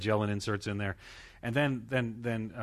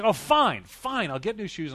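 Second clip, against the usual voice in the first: higher, louder huffy surrender; exasperated giving in; 9 semitones higher, 8 dB louder, slower than usual. Retold or imitated. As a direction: throw up your hands and give in. Giving in to wife.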